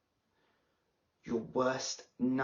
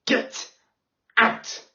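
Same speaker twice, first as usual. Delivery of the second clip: imitating a teacher losing it, higher on out; mock yell; 7 semitones higher, 5 dB louder than usual. Pupil imitates teacher yelling at her, mock yell. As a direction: snap it like a cornered teacher. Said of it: "the voice you try to use when you end up yelling".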